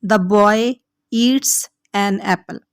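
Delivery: very slow, each word laid out for learners; slow model sentence. Shown as a demonstration. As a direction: dictate it word by word to the class. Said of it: "teacher models an English sentence".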